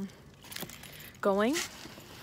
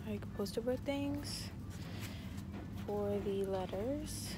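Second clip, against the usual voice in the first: hushed voice, much softer; quiet; 8 dB quieter than usual. Real, not acted. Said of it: "I'm not gonna talk loud".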